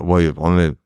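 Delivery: ordinary talk, nothing marked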